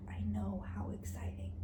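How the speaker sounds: soft unvoiced whisper aside. Real, not acted. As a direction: whisper it so no one wakes.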